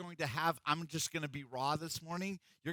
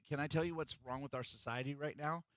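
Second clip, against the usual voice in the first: ordinary talk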